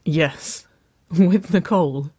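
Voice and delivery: amused confirmation through a giggle; giggling. A narrator's reading. Woman confirming her date to sister, giggling. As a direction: let a little laugh lift the words. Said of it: "Waverley giggled".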